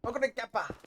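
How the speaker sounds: high, soft mock announcer call; commentator impression. Gamer mimicking anime's horse name call, high mock excitement. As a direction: imitate an anime name call with punched endings. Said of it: "They go".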